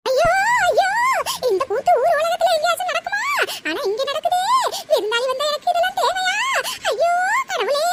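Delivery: hammed-up crying to God; tearful wailing. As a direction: wail in outrage like a wronged old woman. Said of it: "அழுதுட்டு இருக்கீங்க".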